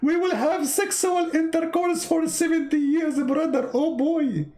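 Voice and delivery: high, gushing mock excitement; mock excited. Mosque crowd cheering the promise, high mock excitement. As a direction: ham up a thrilled worshipper. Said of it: "The guy is so excited".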